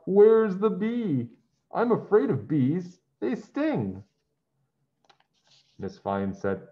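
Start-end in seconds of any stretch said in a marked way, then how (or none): 0.0-4.0 high, loud, slow cry of fear; frightened yell. Acted. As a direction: shout from under the desk in a panic. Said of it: "He yelled".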